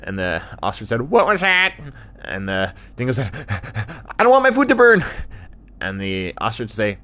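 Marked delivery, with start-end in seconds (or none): 1.1-1.8 high, loud call; raised cartoon holler; ostrich shouting. Ostrich shouts back, can't hear. 4.2-5.0 very high, loud yell; frantic repeated cartoon shout; dingo shouting back. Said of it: "the dingo would shout back".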